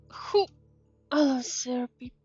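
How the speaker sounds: usual speaking voice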